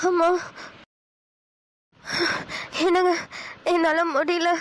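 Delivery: panting between a few weak words; out of breath. Acted. Exhausted wife calls to her husband on the walk, out of breath. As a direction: gasp out that you can't go on. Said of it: "ரொம்ப மூச்சு வாங்குது".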